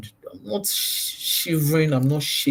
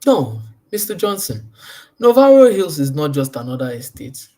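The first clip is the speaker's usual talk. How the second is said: loud, sure realtor pitch, like talking to a friend; confident pitch to a client; 7 dB louder than usual. Shown as a demonstration. Realtor pitching Navarro Hills to Mister Johnson, confident and loud. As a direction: sell it with total confidence. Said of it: "You see the way I'm talking?".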